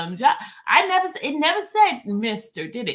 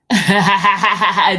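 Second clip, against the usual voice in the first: loud burst of laughter; 3 semitones lower, 9 dB louder than usual. Real, not acted.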